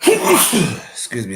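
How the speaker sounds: coughing fit, then excuse me. Real, not acted. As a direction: cough out the words.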